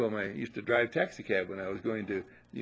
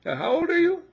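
high, louder mock voice of the passenger; quoting Brian; 9 dB louder than usual. Passenger asking the driver's age, high and loud mock voice. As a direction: pitch up and push it out as the friend. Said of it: "He said".

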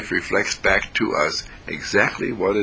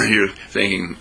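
ordinary delivery

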